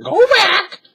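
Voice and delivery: very high, loud parrot squawk. A narrator's reading. Parrot warns the children, high squawk. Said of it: "squawked Polly".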